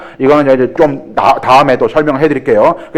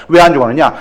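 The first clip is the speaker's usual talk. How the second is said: usual speaking voice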